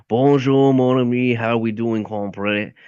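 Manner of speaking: Southern-French Cajun accent, called out. Shown as a demonstration. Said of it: "they have a Southern and, and French accent".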